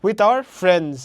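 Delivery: loud, firm, landing on "friends"; stress on FRIENDS. Shown as a demonstration. Teacher showing the main word, loud with stressed "friends". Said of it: "main stress syllable হচ্ছে এখানে".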